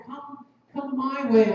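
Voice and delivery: slow, coaxing come-hither call; a hint of a temptress beckoning; seductive temptress. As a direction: draw them in slowly, a touch sly. Folly lures the listener.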